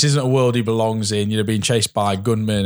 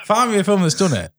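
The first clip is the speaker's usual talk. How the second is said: laughing; 6 semitones higher, slower than usual. Real, not acted. Joking about movie inside arse.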